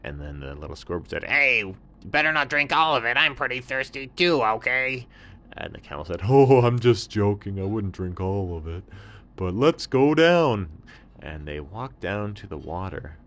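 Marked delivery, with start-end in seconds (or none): 1.2-5.1 high, sly little scorpion; high little scorpion voice, offering help. Acted. 6.2-10.7 lightly voiced surprised camel, louder; a thirsty camel perking up; hint of a thirsty camel.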